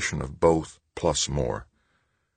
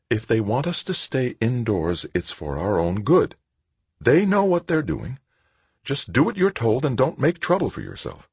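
softened mother; 8 semitones higher than usual. A narrator's reading. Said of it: "She softened".

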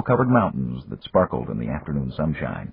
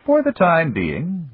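put-on higher, measured voice of an old friend; Japanese magnate; 11 semitones higher, slower than usual. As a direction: voice the older businessman, courteous and calm. Yamagata speaks.

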